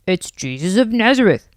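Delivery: lightly voiced man in the crowd. A narrator's reading. Man in the crowd answers Bart, lightly voiced. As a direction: answer as a passer-by, lightly in character. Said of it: "said a man in the crowd".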